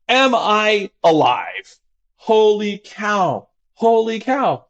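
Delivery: high, very slow amazement; awed wonder. Retold or imitated. Therapist imitating a patient's awed question, high and slow. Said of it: "calmly ask the, the most important question".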